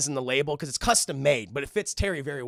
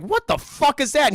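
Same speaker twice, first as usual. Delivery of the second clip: high, loud disbelief; acted shock; 10 semitones higher, 7 dB louder than usual. Batman spotting a villain on a glider, loud astonished outburst. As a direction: blurt it out in hammed-up astonishment.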